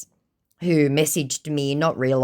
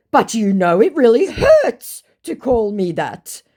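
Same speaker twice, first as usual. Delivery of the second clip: higher, louder wounded protest; mock indignant hurt; 9 semitones higher, 7 dB louder than usual. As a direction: play the offended victim. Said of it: "Completely indignant and hurt".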